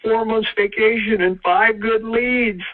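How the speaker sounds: put-on high, slow, drawn-out boy voice; boy's punchline. Joey tells his friend what he got, in a high boy voice. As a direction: crow it high and slow as the joke's payoff. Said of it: "And Joey said".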